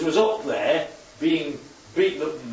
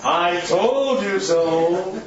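told you so; 4 dB louder, much slower than usual. Pretend. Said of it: "what did Peter see in the look".

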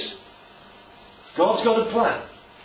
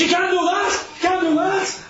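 rebuking shout; 12 semitones higher than usual. Retold or imitated. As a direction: shout him down in alarm. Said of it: "starts rebuking him".

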